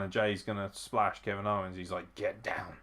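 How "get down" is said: soft, understated imitated command; quiet mock boss instruction; Roman Reigns's order. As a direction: give the order under your breath. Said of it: "he's telling Jay to get down".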